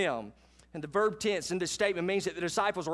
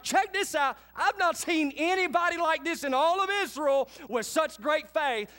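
high, loud, delighted exclaim; amazed Jesus; 10 semitones higher, 3 dB louder than usual. Retold or imitated. Jesus amazed at a Gentile's faith, high and excited. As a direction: marvel out loud. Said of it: "he's like".

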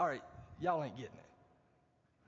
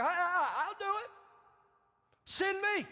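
high, breathless-to-be-picked eagerness; eager volunteering; 7 semitones higher than usual. Acted. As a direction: jump in, pitched right up. Acts Isaiah jumping to volunteer.

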